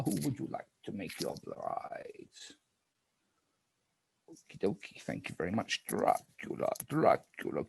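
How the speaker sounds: low, hushed night-phase calls; spooky game narrator. Acted.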